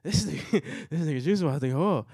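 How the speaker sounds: high, loud hyped-up imitation of a friend; retold alarm. Storyteller voices friend seeing trouble coming, hyped-up mock alarm. Voicing friend who saw it.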